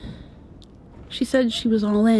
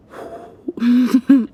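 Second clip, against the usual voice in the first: a bashful little laugh, louder; shy laugh; 4 semitones higher, 8 dB louder than usual. Real, not acted. Shy laugh to her girlfriend before reassuring her. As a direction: laugh to cover the nerves.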